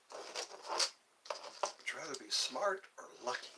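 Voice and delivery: slow breathy hush, drawn out; slow secretive whisper. Acted. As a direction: whisper it slowly to the viewers. Repeated whispered question, slower.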